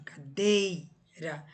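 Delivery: cadeira with a long, strong DEI, ca-DEEI-ra; "Cadeira" slowed, weight on the middle syllable; stress on DEI. Shown as a demonstration. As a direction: stretch and punch DEI in "Cadeira". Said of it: "A mais forte é a penúltima".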